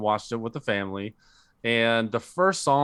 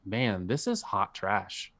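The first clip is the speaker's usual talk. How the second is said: slow, softer deadpan verdict; retold disgust; 2 semitones lower, 5 dB quieter, slower than usual. Podcaster recalling his letdown at the Matrix film, understated disgust.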